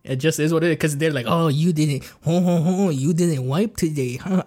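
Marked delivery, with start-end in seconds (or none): 1.3-4.4 hammed-up French accent with a ho-ho-ho; mock French accent. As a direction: put on a silly French accent, drawing out the taunt. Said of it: "I don't know why it sounds French".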